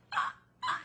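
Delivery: high, breath-caught "ah, ah"; imitated crying gasps. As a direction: mimic a fan too choked up to speak. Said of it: "she can't get a word out, and she's crying".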